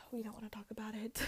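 hushed, fast whisper. Retold or imitated. The cast answering Hopper, whispered fast. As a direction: breathe it out quickly under your breath. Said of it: "And they're like".

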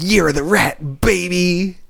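high, put-on taunting voice; hammed-up note reading. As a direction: ham up a bizarre written taunt. Performs the note left as tip.

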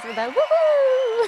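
high, slow cheering woo-hoo; woo-hoo sound effect. Acted. Host mimicking the sound effect, high cheering woo-hoo. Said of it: "I don't know what sound effect that is".

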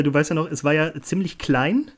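ordinary talk, nothing marked

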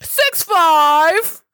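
loud, high, drawn-out arena introduction; hype announcer. Pretend. Introducing a favorite player to the crowd, hammed-up announcer. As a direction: build to a long shouted name. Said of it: "I should be an announcer".

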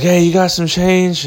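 light mock of a woman begging for change; lightly voiced lady asking for change; panhandling lady. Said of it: "She's like".

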